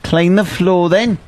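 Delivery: lightly put-on English order; hacky English accent. Host ordering intern to clean in a mock English accent. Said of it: "our hacky English accent".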